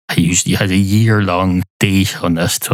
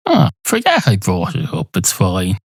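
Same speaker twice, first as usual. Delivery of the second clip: usual speaking voice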